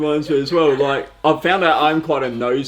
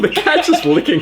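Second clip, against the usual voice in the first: very high squeal of laughter; helpless laughter; 4 dB louder than usual. Real, not acted. Hosts spotting the cat licking itself, helpless laughter. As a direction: splutter the words through real laughing.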